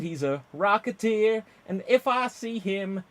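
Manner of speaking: a folksy American country singer greeting the crowd; American country accent. Acted. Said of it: "anytime you do an American accent".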